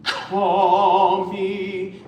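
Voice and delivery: sung "Call" with a strong K attack; "Call me" sung slowly, crisp K on "Call"; hard K. Shown as a demonstration. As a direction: hit the K of "Call", then hold the note. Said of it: "more K consonant".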